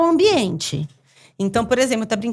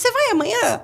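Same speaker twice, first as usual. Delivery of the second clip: put-on much higher, sing-song kid asking; child's voice; 12 semitones higher than usual. Mother imitating classmates asking about the party, high and childlike. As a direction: chirp it like a curious schoolkid.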